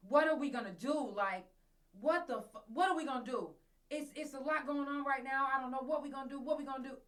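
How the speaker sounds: loud, high, frantic repeated questions; panicked man's voice. Retold or imitated. Vlogger replaying the stranger's voice from her phone, loud and high. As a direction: mimic a distraught man spiralling into panic. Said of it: "and it was like".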